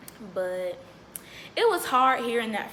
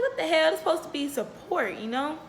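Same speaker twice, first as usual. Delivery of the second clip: higher-pitched, indignant outburst; retold indignation; 6 semitones higher than usual. Patient reacting to a rude clinic worker, retold with indignation.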